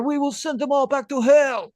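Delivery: high, loud, fiery Argentine politician; Javier Milei impression. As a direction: rant like a fired-up Milei. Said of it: "he says".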